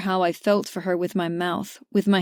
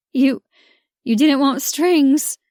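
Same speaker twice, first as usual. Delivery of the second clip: higher, louder, halting through tears; tearful disbelief; 6 semitones higher, 5 dB louder, slower than usual. A narrator's reading. Georgia to Mac after his confession, tearful and stunned. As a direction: stammer it out, overwhelmed and crying.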